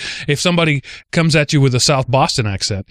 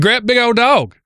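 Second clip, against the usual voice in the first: retold East Texas accent; 10 semitones higher than usual. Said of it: "I said".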